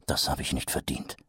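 Vimes's inner voice, hushed; hushed thought. A narrator's reading. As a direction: think it bitterly. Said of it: "dachte er".

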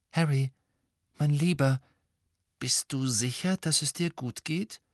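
gently pressing, voice lifting into the question; worried. A narrator's reading. Misses Weasley checks on pale Harry, worried. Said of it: "sagte Misses Weasley besorgt".

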